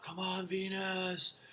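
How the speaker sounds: high, drawn-out cheer at normal volume; mock crowd shout. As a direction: imitate a fan calling out slowly. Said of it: "shouting out".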